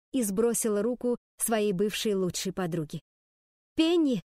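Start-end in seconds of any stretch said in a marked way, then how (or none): 3.7-4.2 loud high call; calling out while running. A narrator's reading. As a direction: shout the name after her. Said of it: "кричала я на бегу". (громко окликая на бегу)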